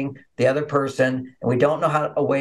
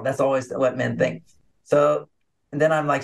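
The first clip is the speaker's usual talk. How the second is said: ordinary delivery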